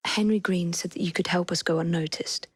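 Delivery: soft unvoiced whisper; stealthy whisper. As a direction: keep it hushed, don't be heard. Sneaking, asking for help.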